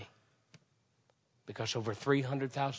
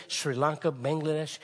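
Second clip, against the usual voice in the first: normal talking voice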